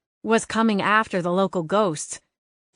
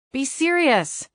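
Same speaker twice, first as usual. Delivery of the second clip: exasperated; 8 semitones higher, slower than usual. Acted. Scolding the joking cat.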